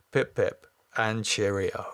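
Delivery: ordinary delivery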